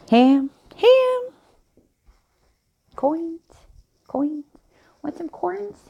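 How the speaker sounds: "Ham, ham" and "coins" tried high; practicing her ham and coins. Shown as a demonstration. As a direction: test "coins" high, searching for her sound. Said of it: "I don't even know how she said it".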